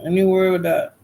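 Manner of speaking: usual speaking voice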